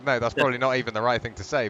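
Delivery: usual speaking voice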